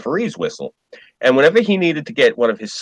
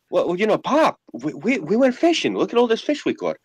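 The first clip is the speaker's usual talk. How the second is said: sheepish son; 8 semitones higher than usual. Retold or imitated. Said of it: "son answers father".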